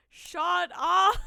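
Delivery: loud mock shout. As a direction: yell it out, laughing. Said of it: "I'm like".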